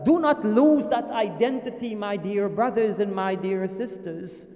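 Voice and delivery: ordinary talk, nothing marked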